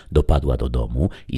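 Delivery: ordinary delivery, nothing marked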